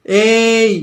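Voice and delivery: a long, drawn-out, loud "এই" held high; prolonged call. Shown as a demonstration. Teacher showing students a prolonged vowel, drawn-out "এই". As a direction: stretch "এই" out as if calling someone far away. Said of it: "যেমন যদি বলি".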